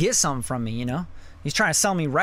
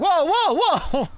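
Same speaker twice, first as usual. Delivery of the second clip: high, loud startled whoa; shocked whoa; 13 semitones higher, 4 dB louder than usual. Retold or imitated. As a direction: recoil in alarm. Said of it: "You're like".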